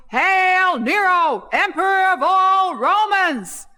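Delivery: high, slow, drawn-out mock proclamation; mock hollering. Party guest jumping on the sofa in a toga, hollering grandly. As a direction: holler it like a toga-clad herald, stretching the words. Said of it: "jumping up and down and hollering".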